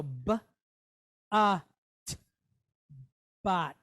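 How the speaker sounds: "b, a, t" as separate sounds, then blended "bat"; sounding out. Shown as a demonstration. Teacher building a syllable, sounding out "bat". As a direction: sound out "b", "a", "t" with pauses, then join them. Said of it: "we stick the tav on the end".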